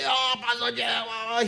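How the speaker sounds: high, slow, drawn-out call of a name; excited greeting. Retold or imitated. Pastor mimics the stroke patient excitedly greeting him. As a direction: mimic an old man overjoyed to see his pastor. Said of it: "he was so excited".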